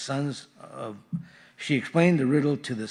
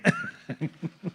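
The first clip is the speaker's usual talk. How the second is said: high laugh; 5 dB quieter than usual. Real, not acted. Laugh after honeymoon joke.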